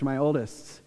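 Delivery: ordinary talk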